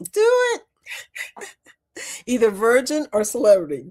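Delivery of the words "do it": excited. Real, not acted. Urging viewer to cruise.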